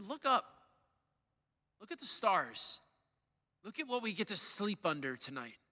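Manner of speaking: lightly voiced soldier, gently pointing skyward; quiet wonder. Team leaders' calm answer.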